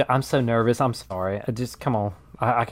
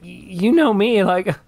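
normal talking voice

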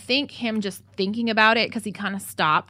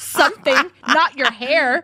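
raised, louder mock scolding; exasperated telling-off; 5 semitones higher, 5 dB louder, slower than usual. Retelling scolding Crystal for doing her hair, loud exasperation. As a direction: scold in retelling, pitch and volume up.

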